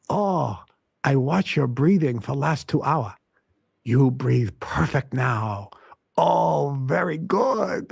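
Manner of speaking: imitating an old Chinese master's heavy accent, slow and pleased; slow, drawn-out Chinese-accented praise; Grandmaster Tai's Chinese accent. Recalling his master praising his breathing, in a Chinese accent. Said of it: "he spoke with a pretty heavy accent".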